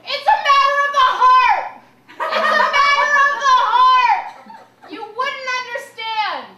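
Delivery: put-on high, loud drunken wail; screaming. Fleeing cops in the park, loud and high. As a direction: wail it dramatically. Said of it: "running around Horner Park screaming".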